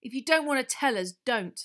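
snapped. A narrator's reading. Ron to Hermione, snapping. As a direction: snap it back. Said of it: "snapped Ron".

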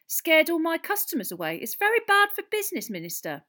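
lightly edged landlady's protest; slight edge. A narrator's reading. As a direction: complain to the Minister with a mild edge. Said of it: "a slight edge to her voice".

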